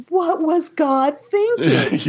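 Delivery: high, slow mock lament; retold anguished outcry. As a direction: cry out to the sky, drawn out. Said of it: "just sobbing in the chair".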